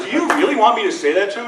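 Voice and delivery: high, loud, rushed question; mock incredulity. American lawyer checking on the threat, loudly incredulous. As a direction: blurt it out as if appalled by the order. Acted incredulous colleague in retelling.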